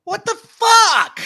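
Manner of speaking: high, loud and slow, disbelieving outburst; what the heck, said in loud disbelief; outraged exclamation. Real, not acted. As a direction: blurt it out in shocked outrage. Outraged reaction to close election.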